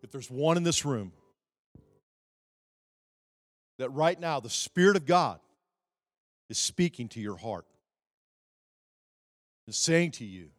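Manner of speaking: loud, high, fervent preacher cry; pleading preacher altar call. Real, not acted. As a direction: preach the altar call at full force over music. Altar call at full force.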